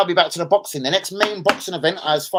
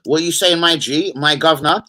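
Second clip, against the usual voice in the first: normal talking voice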